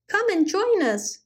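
high, bright call; cried out. A narrator's reading. Goblin calling to the frozen rabbits, high and eager. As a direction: wave them over with your voice. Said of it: "he cried to".

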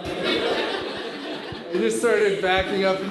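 drawn out through laughter; slow, laughing. Real, not acted. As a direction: stretch it slowly while laughing.

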